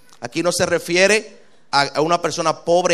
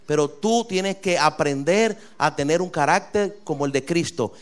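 ordinary talk

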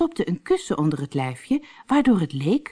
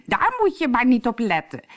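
high, breezy mock-bride voice; squeaky disguise voice; 8 semitones higher, 3 dB louder than usual. Harlekijn in disguise covers up, squeaky voice. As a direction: brush it off in a squeak. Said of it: "Ik piep zo".

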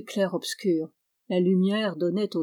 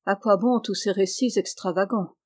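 plain reading voice

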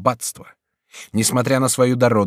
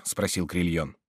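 ordinary delivery

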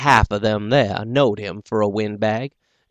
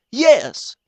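high, clipped yes; high gentleman's yes; doctor voice; 5 dB louder than usual. Acted. Doctor character voice.

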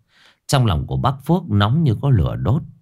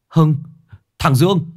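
high, loud, panicked news of a death; panicked shout; 9 semitones higher, 3 dB louder than usual. A narrator's reading. Man rushing to tell the father his son died, panicked. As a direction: blurt it out in terror. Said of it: "chạy xe tới hốt hoảng".

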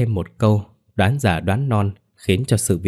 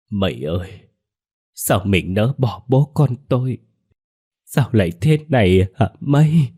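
acted grieving husband, rising into a raised wail; mournful lament; 2 semitones higher than usual. A narrator's reading. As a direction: draw out the anguished questions to a dead wife. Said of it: "Bảo Gù cũng cúi xuống bên xác vợ than thở". (than thở đau xót)

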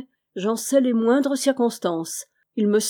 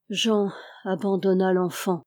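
ordinary delivery

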